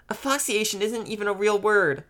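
put-on high-pitched childish protest; indignant little sister. A narrator's reading. Little sister disputes brother's Hangman word, indignant.

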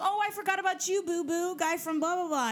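high mock-apologetic coo; playful magician. Voicing the magician's playful fake apology.

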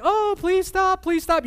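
high, loud strained pleading; parent in public. Retold or imitated. Parent pleads with kids at the store, high strained. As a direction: sweetly beg the kids in the store. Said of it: "you're like".

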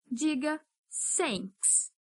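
normal talking voice

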